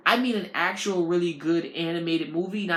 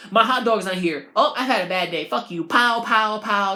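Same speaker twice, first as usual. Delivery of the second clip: loud mock rage; 3 semitones higher, 6 dB louder than usual. Imitating the angry customer shooting.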